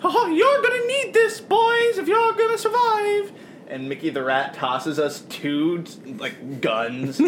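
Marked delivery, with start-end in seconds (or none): 0.0-3.3 high, squeaky rat voice; rat creature voice. Acted. Mickey the Rat hands over guns.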